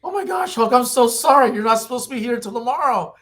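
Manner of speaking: put-on high, fluttery old-lady apology; a high, fussing little old lady; flustered old lady. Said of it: "Betty runs to him and says".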